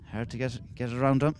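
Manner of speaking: ordinary talk, nothing marked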